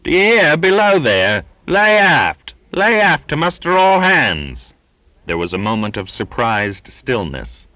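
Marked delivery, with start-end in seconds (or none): 0.0-4.7 high, loud commanding call; authoritative boatswain. A narrator's reading. Boatswain summoning the crew aft, commanding call. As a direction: call all hands from outside the door. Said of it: "voice rose ... with an authoritative note in its drawl".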